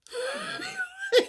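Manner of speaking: big high-pitched laugh; cackling laugh. Real, not acted. Laughing at own joke.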